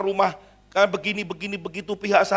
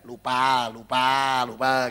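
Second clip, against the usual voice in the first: mock teasing; 4 semitones lower, much slower than usual. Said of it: "nggak nyindir sama dia".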